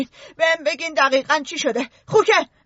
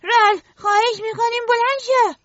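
higher, urgent frightened begging; frightened plea; 7 semitones higher than usual. Acted. Eric begging sleeping Ralph to get up, frightened. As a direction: plead with him to wake up, scared. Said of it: "اریک با ترس گفت".

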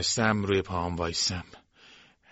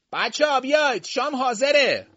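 calling out; 8 dB louder than usual. A narrator's reading. Servant calling the children in to dinner, long high call. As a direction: sing out the dinner call from far off.

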